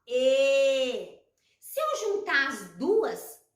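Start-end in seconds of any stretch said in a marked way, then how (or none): 0.1-1.2 E held long and loud; letter sound demo. Shown as a demonstration. Teacher showing the sound of E, sounded out. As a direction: sustain a steady E. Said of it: "E a E faz assim".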